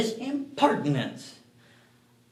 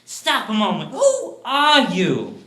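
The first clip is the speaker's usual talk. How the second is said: alarmed demand; 9 semitones higher, 9 dB louder than usual. Acted. Man demands to know who the intruder is, alarmed. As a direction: halt him, then stretch the question out loudly.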